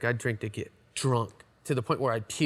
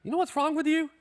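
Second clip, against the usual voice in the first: put-on much higher voice, checking on drunk buddies; hammed-up caring bandmate; high-pitched mock check-in on the tour bus; mock concerned sober friend; 14 semitones higher than usual.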